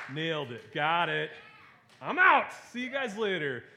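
mock-triumphant shout, jumping up high; cocky triumph. Kid boasting to class after reciting shortest verse, mock triumphant. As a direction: celebrate loudly like you've aced it and are leaving.

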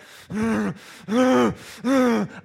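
repeated forceful psych-up grunts; psycho growling huffs. Acted. Lifter psyching up to Metallica, psycho growling huffs. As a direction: growl out bursts of breath like a psycho. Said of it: "turning into a psycho".

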